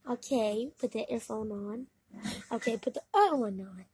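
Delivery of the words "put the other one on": usual speaking voice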